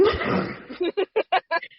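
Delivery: a real high burst of laughter. Real, not acted. Host cracking up while reading the disclaimer, real laugh.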